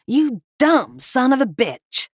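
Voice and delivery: high, loud, slow snap; angry snap. Acted. Cliff snapping furiously at his partner Kevin. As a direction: spit the insult out. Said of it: "Cliff snapped".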